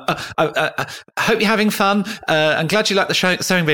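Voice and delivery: high, flustered and stammering, a celebrity out of his depth; nervous high stammer through the greeting; panicky nervous greeting. Retold or imitated. To a fan's friend in surgery, a high, flustered, stammering greeting. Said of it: "I'm like".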